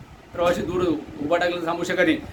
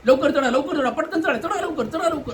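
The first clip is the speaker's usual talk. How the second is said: high, pushy calls to hurry up; rushing bus conductor, high and insistent; 8 semitones higher than usual. Retold or imitated. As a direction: mimic a conductor rushing people aboard. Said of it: "प्रवाशांना घाई करण्यात येतं".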